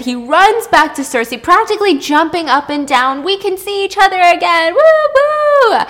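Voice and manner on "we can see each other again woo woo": sing-song, much higher and louder, ending in a whoop; overjoyed whoop. Acted. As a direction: cheer like a lovesick boy who just won. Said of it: "Glaucus is so excited".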